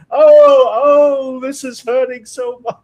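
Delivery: milk the fake injury for laughs; overacted pain. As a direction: counselor overacts agony to untraumatize scared kids. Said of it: "completely overact going".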